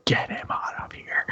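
breathless whisper, almost crying; whispered plea. Acted. Petra begs the party in a whisper to take Grizz away. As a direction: beg it in a whisper. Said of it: "Petra looks at you and says".

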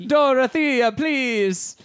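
put-on high, loud Italian accent. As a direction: ham up an Italian dad's accent. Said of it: "can you do it now?".

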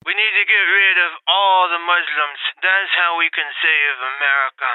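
a hint of Brando playing Trump, low; Marlon Brando impression. [name] doing Brando as Trump for listeners, low mumble. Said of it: "my impersonation of Marlon Brando playing Donald Trump".

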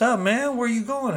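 high-pitched call to a newcomer; high, keen questioning hello; eager greeting. Retold or imitated. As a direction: imitate the homies' welcome, pitch way up. Retold homies greeting the newcomer.

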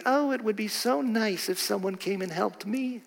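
a passive wish said softly; mock wistful thought. Preacher mocking a calm wish in quiet voice. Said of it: "you're not going to be in your mind thinking".

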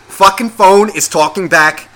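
fed up with the talking phone; exasperated. Real, not acted. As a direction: bark it at the phone, slowly.

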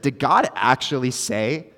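put-on a light, slow Valley Girl lilt on Satan's question; Valley Girl accent. Preacher voicing Satan's question to Eve in a light Valley Girl accent. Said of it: "like, a Valley Girl accent to that".

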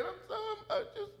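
high, soft, rushed tearful babble; imitated tearful caller. Preacher mimics people calling him in tears, imitated tearful. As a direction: imitate someone upset, high and quick. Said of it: "People call me crying".

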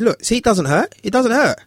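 high, gentle sing-song coaxing; coaxing child. Retold or imitated. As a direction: barber coaxing the scared boy to touch the clippers. Reassuring child.

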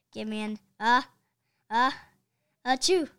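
loud sneeze after a stuttering build; big comic achoo. Acted. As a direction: hold back then burst into a sneeze. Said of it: "sneezed the cheerleaders".

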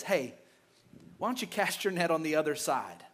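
lightly voiced Jesus, softly suggesting; gentle suggestion. Jesus gently suggests to the tired fisherman, soft and low.

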